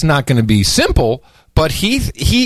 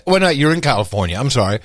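normal talking voice

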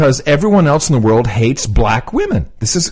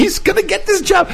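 exasperated. Real, not acted. Exasperated outburst in argument.

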